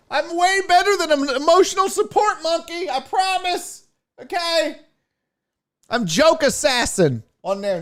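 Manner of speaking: chirpy merch-table host. Retold or imitated. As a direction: hype up the fans like a cheery vendor. Comic retells his own merch-table patter.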